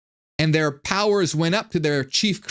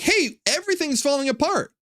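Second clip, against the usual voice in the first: much higher mock alarm; alarmed followers; 13 semitones higher than usual. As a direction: squeal it like a panicked follower. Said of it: "they're like".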